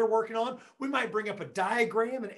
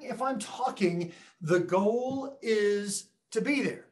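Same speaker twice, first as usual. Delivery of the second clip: ordinary talk, nothing marked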